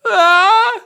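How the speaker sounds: very high, loud hammed-up shriek of despair; mock anguished shriek. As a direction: let out a howl like a betrayed fan. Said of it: "That's my maga impression".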